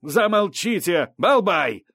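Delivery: high, slow, loud order to be silent; sharp authoritative outburst; croaked command. A narrator's reading. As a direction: squawk a furious reprimand. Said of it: "каркнула Сарт".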